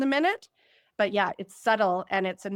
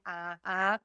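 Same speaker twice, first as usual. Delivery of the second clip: soft refusal; 2 semitones lower, 6 dB quieter than usual. Retold or imitated. Emotional listeners refusing right now, a quiet uh-uh. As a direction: mutter a no from the audience. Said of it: "emotional authority saying".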